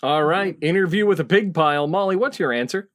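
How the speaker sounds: ordinary talk, nothing marked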